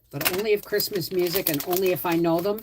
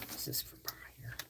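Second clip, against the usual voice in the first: whisper; 8 semitones lower, 21 dB quieter than usual. Real, not acted. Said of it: "whispered aside".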